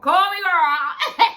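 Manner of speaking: high, loud, drawn-out holler; playful fangirl call. Pretend. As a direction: stretch it out high and loud, breaking into laughter. Playful plea to favourite celebrity.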